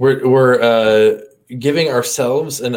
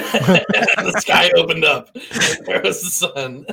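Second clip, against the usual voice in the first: laughing through the words, slowed right down; real delighted laughter while telling it; 8 semitones higher, slower than usual. Real, not acted. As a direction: giggle out the punchline of your own story.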